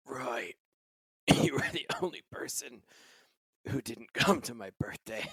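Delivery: faint, breathless whisper through laughter, high; dying whisper. Acted. Dying Cheryl whispers her last words to Roy. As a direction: gasp the words out like she's dying.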